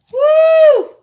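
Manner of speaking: high, loud whoop of surprise; sudden high yelp at the fumes. Real, not acted. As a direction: let out a sharp high whoop. Onion fumes hit her.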